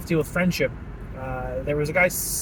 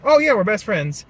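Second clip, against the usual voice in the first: loud, higher, eager agreement; imitated friendly declaration; 5 semitones higher, 7 dB louder than usual. Imitating guy in the reel about work friends, loud eager agreement. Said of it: "the guy in the video was like".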